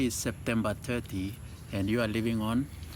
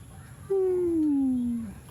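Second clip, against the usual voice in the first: dramatic gasp. Acted. Dramatic reaction to leaving news.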